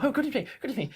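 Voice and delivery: high, fretting dandy muttering; a flustered high aside; fussy tailor voice. Acted.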